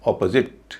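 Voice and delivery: अपोजिट as "O-puh-zit", first vowel open; corrected pronunciation. Shown as a demonstration. Teacher giving the rule's result, corrected pronunciation. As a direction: stress the first of अपोजिट, shrink the middle. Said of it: "तो इसको बोला जाएगा".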